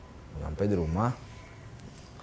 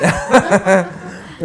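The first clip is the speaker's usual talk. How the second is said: a quick burst of laughter; short real laugh; 4 semitones higher than usual. Real, not acted. Father laughing while recalling his daughter's elopement. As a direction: laugh it off, amused.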